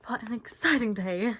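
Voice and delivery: high, loud, gushing warble; warbling. Acted. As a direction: warble it like a giddy host. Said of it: "she warbles".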